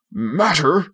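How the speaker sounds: bristling, half-hearted shout; mild mock yell. A narrator's reading. Father snaps at his wife over his tie, mild mock yell. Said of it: "he yelled".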